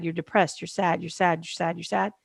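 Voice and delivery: soft, fading parrot of "You're sad"; quiet weary mockery of outsiders' pity; mocking repeated pity. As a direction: mimic the same pitying line over and over, dropping away. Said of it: "always talks about the sadness".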